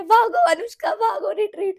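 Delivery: high, loud, slow mock alarm; mock panic, yelling retreat; panicked yell. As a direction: scream at yourself to run away. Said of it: "मैंने बोला".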